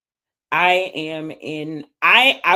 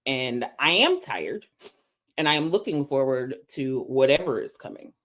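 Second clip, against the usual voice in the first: normal talking voice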